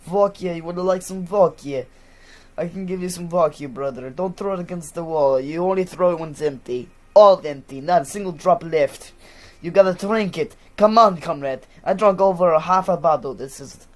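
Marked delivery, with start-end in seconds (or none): 0.1-4.1 put-on Russian accent, louder and higher. Offering vodka to a comrade, in a Russian accent. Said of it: "it's always in a Russian accent". 4.1-13.3 loud, higher mock-Russian drinker; Russian accent. Urging a comrade to drink, in a loud Russian accent. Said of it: "it's always in a Russian accent".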